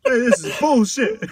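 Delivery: high, loud, laughing mock outrage; laughing protest. Mock prisoner objecting to his mugshot, laughing loudly. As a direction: protest through a burst of laughter.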